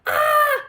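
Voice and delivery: very high, loud startled ah; startled cry. A narrator's reading. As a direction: yelp in fright. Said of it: "said the innkeeper".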